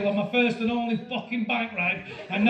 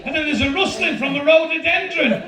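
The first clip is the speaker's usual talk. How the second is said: ordinary talk, nothing marked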